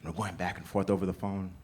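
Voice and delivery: usual speaking voice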